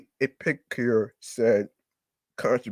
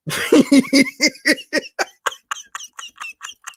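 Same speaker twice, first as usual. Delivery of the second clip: real high-pitched laughing fit, breathless; high helpless laughter; 3 dB louder than usual. Real, not acted. Vlogger cracking up at scorpion lollipops, high wheezing laugh. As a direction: let the laugh run away from you.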